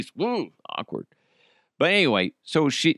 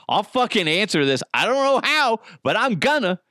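mock determined outburst; 9 semitones higher than usual. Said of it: "I was like".

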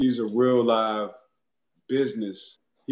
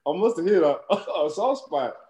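laughing retort; 6 semitones higher than usual. Retold or imitated. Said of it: "I said".

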